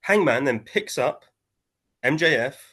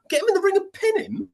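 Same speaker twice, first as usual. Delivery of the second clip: high, disbelieving repeat of the advice; incredulous echo. Retold or imitated. Pundit mocking commentator's pin advice, high incredulous squeak.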